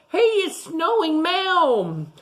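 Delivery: put-on high, slow little boy; boy's voice. A narrator's reading. Teacher voicing a boy in the story, high and slow. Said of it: "A boy looked up".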